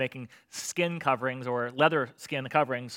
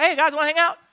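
high, loud breezy greeting; casual friendly God; 13 semitones higher, 9 dB louder than usual. Acted. God dropping in on Adam and Eve, breezy and high. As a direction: greet them like old buddies.

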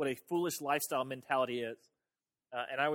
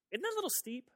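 pitched up high in mock outrage; mock indignant disbelief; 10 semitones higher than usual. Preacher mocking the mirror superstition with incredulity. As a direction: act scandalised at the steep penalty.

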